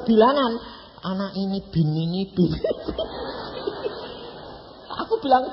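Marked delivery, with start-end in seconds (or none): 2.6-4.1 breathless laugh. Real, not acted. Laughing at the Bible's begats.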